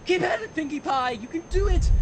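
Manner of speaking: high, bright cheering; cheery trainer. Acted. Rarity cheering on sweaty Pinkie at gym, chirpy encouragement. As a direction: pump her up with cheer. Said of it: "Rarity said".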